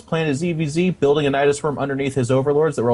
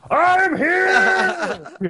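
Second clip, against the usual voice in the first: much higher, louder, long held cry; worm yelling; 14 semitones higher, 4 dB louder than usual. Retold or imitated. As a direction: announce yourself like a worm bursting out of the ground. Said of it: "the first thing it does is go".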